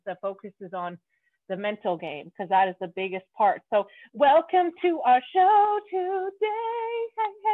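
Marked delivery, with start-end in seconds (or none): 4.1-7.5 higher, slow, drawn-out host welcome; excited welcome. Real, not acted. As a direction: roll out the welcome like a show host.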